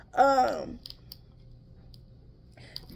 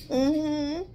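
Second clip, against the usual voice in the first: ordinary talk, nothing marked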